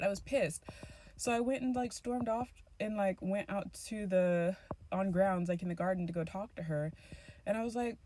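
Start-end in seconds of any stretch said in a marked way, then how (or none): none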